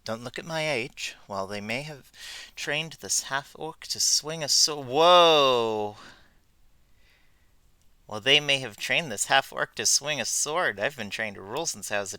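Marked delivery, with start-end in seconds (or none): 4.8-6.0 sudden loud startled yelp; real surprised outburst; startled whoa. Real, not acted. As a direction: jump at something on screen. Startled mid-sentence while reading.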